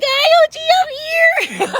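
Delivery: very high, loud sweet sing-song; sing-song wake-up. Retold or imitated. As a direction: coo it to wake a sleeping friend. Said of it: "wake her up and be like".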